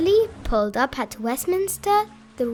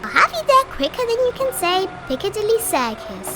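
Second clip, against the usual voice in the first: higher, lightly voiced barge promise; promising barge; 9 semitones higher, 3 dB louder, faster than usual. A narrator's reading. Dilly promising Thomas, lightly voiced and higher. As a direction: reassure him cheerfully. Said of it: "Dilly promised".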